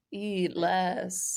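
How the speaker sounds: slight mock British hypnotist; attempted British accent. Co-host mimics a hypnotist's advice, lightly attempted British accent. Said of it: "trying to do it in a British accent".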